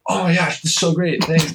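high-pitched imitation of grateful neighbors; mock gushing. Older neighbors thanking young helpers, mock overjoyed. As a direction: gush like their lives are changed.

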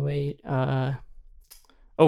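ordinary delivery

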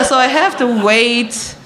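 ordinary delivery

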